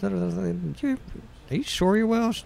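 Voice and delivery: high, mimicked disbelief; mock doubtful question. Pressing the visitor from Wales, mock doubtful question.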